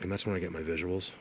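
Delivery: ordinary talk, nothing marked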